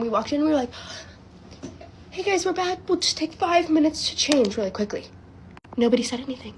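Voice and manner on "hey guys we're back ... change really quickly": high, innocent mock greeting; feigned casualness. Late friends greet the family, feigned casualness. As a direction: act as if nothing happened.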